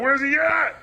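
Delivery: high, rising holler; mock baffled yell. Kane searching for Undertaker in the dark, high mock yell. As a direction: call out as if searching frantically.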